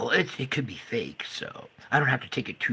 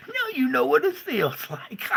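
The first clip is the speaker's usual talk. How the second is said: high, cackling told-you-so; gloating laugh. Pretend. Gloating at the doubters, laughing. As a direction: cackle with smug revenge. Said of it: "I'm gonna laugh".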